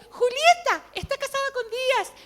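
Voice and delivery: high, loud astonished announcement; excited calling out. Retold or imitated. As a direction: call it out in amazement. Pastor calls out to confirm prophecy.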